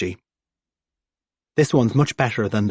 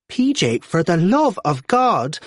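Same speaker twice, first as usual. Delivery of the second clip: high, drawn-out exasperated call; exasperated mam; 7 semitones higher than usual. Acted. Mam on her way back scolds P J, high and slow. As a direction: call him out like a fed-up mother.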